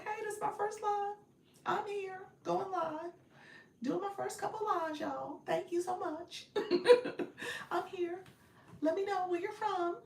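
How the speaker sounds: cheery chipmunk effect greeting. Shown as a demonstration. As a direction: demo a first live greeting in the chipmunk filter. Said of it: "You change the voice".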